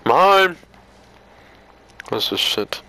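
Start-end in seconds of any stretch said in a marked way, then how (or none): 0.1-0.6 loud high claim; snatch it with a shout; excited. Real, not acted. Grabbing item.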